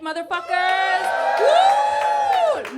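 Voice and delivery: high, loud whoop at the crowd; crowd hype shout. Real, not acted. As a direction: get the room cheering. Hyping the crowd.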